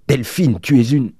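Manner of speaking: high, loud accusation cut short; angry outburst. A narrator's reading. Countess about to insult her sister, angry outburst. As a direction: burst out furiously, break off mid-insult.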